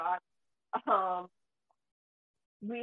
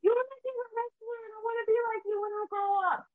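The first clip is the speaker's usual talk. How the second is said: put-on high, excited little-girl voice, much higher and louder; imitating her little daughter; 14 semitones higher, 5 dB louder than usual. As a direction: squeal it like an eager six-year-old. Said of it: "she goes".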